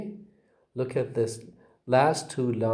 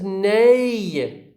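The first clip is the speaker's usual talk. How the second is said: "nei" drawn out and loud, set apart; bigger stress; 7 semitones higher, 4 dB louder than usual. Shown as a demonstration. Teacher splits up resignation, long emphatic "nei". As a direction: stretch "nei" as the main beat. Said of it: "The second one is bigger".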